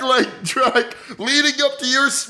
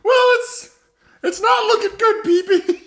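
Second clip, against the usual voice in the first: put-on higher old woman's voice, cracking a little with a laugh; old lady voice; 7 semitones higher than usual. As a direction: play the forgetful old grandma, pitched up. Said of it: "It's me, Miss Butter".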